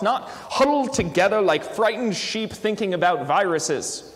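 put-on light British accent for a read-aloud quote. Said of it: "it's written in a British accent, so I have to read it that way".

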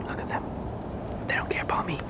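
very soft, hushed commentary; stealthy whisper. Pretend. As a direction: whisper as you creep up on the ducks. Creeping closer to wild ducks.

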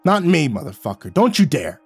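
Jack snarling, then shouting at the narrator; snarled. A narrator's reading. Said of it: "Jack snarled".

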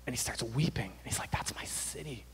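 near-whisper, very soft and low; hushed grief. Retold or imitated. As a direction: retell his sorrow in a hushed voice. Retells Nehemiah weeping over city.

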